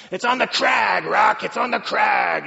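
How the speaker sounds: loud, high gruff trainer's shout, slowing on the second line; Mickey shouting. Retold or imitated. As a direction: bark it like an old boxing coach in the corner. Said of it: "it makes me think of his trainer, Mickey".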